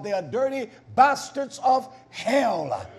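ordinary talk

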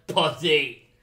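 loud voice of the alien symbiote; Venom. Retold or imitated. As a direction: blurt it as an imitated movie-monster line. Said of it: "and Venom's like".

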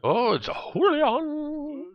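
high, drawn-out falsetto coo; playful greeting. Pretend. Gamer greets Julian turning up, high playful falsetto. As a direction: stretch the name out high and slow.

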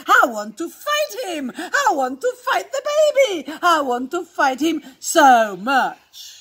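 loud, eager play-fight cry; exuberant, forceful exclaiming; boisterous cousin. Acted. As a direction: punch it out with rowdy glee. Boisterous cousin wants to fight baby.

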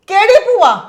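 high, loud indignant question; indignant outburst. Acted. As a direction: fire it back, offended. Mother angry at the mention of the aunt. (ਭੜਕ ਕੇ ਸਵਾਲ)

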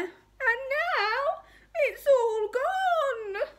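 slow, very high tearful wail; hammed-up baby bear lament; crying Baby Bear. A narrator's reading. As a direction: drag it out like a sobbing cub. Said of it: "cried Baby Bear".

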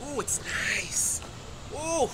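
slow breathy whisper of delight; whispered ooh of pleasure. Acted. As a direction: savour it in a whisper.

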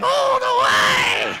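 slow, loud, high yell; drawn-out shout. Acted. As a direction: stretch it out and yell it. Lion sneaking toward his prey.

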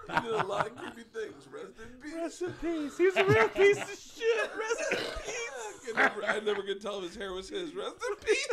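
high, breaking laugh-talk; real cracking up; helpless laughter. Real, not acted. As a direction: force the eulogy out through laughter. Cracking up repeating mock eulogy.

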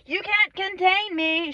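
high, slow dramatic declaration; mock defiance. Vlogger voicing her unruly hair, high mock defiance. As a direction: stretch it out in playful triumph.

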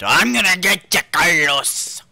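high, loud sudden threat; mock threatening shout. Player hunts Carlos in the game, threatening shout. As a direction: holler the threat at your prey.

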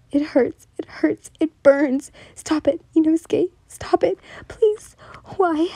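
a desperate, crying plea; tearful pleading. Acted. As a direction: plead through the pain to stop. Victim pleading in pain.

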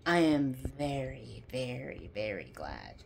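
dying away; fading emphasis. Real, not acted.

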